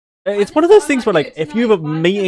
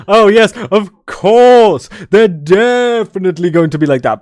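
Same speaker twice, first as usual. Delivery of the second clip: over-the-top sarcasm; 4 semitones higher, 6 dB louder than usual. Shown as a demonstration. Brit showing how people imagine British humour, over-the-top sarcasm. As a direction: stretch it out with huge theatrical sarcasm. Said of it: "extremely over-the-top sarcasm".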